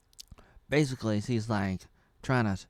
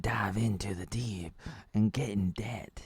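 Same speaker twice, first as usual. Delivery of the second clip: hushed, voiceless aside in character; mock whisper. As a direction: whisper it as a solemn rule. Elf's quote ends in whisper.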